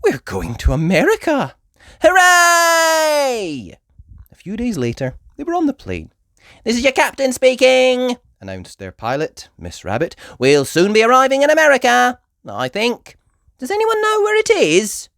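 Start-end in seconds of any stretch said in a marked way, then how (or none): 0.0-3.8 high, loud hooray; excited cheer. Acted. Peppa cheering. 6.6-15.1 high, loud, fast captain voice; cheerfully clueless pilot; Miss Rabbit the pilot. Acted.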